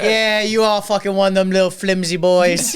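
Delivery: loud, high scornful Bostonian; Jerry. Retold or imitated. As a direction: sneer at them in a Boston accent. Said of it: "he was not liking that".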